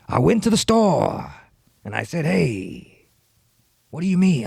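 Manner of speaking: hammy actor rapping, high and stretched out; bad Al Pacino. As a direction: bursts of loud, drawn-out movie-star mimicry. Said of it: "just a very bad one doing rapping".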